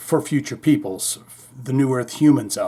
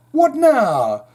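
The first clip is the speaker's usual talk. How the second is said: [name]; 10 semitones higher, 5 dB louder than usual. Acted. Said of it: "I said".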